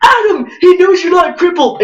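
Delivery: high, loud yell to a running friend; panicked yell. Retold or imitated. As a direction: shout the warning and run. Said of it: "I literally yelled".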